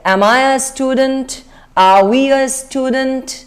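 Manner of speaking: very slow, rising question on student. Shown as a demonstration. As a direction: stretch it out and lift the end. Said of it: "question tone में पूछो". (धीरे-धीरे, आखिर में ऊपर जाती tone)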